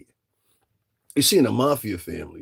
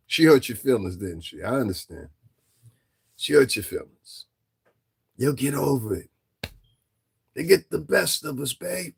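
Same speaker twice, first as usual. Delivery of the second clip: pat him on the back sarcastically; mock consolation; 3 semitones higher than usual. Real, not acted.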